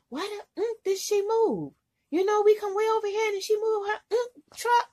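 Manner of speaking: high-pitched mock angry outburst; imitating cursing rant. Trainer fuming that her sister moved the truck, imitated angry rant. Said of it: "starts cursing ... getting loud and boisterous".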